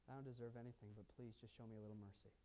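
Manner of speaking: soft, humble whisper of a plea; softly voicing a humble prayer for mercy. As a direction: hush down and beg for just a little.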